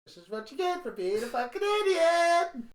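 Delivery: loud, high gloating jeer; mocking taunt. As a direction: rub it in mockingly. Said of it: "I was like".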